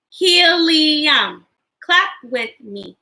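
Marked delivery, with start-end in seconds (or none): 0.1-1.4 HELIUM drawn out in three joined beats; broken into syllables. Shown as a demonstration. Teacher breaking words into parts with children, Helium drawn out in syllables. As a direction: stretch Helium into he-li-um. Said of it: "Break words into parts".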